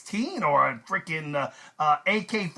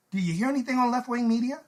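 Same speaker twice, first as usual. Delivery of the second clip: ordinary talk, nothing marked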